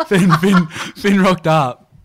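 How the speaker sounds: can't get the name out for laughing. Real, not acted. Dad telling the mates about Finn's formal, slowed and laughing through it.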